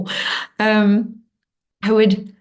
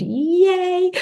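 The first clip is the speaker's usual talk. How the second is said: much higher squeal of joy; excited cheer; 12 semitones higher than usual. Real, not acted. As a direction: cheer like she's thrilled. Cheering a participant's comment.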